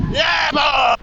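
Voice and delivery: high, shouted cheer; mock holler. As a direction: holler it out to the street. Repeats the British heckling holler.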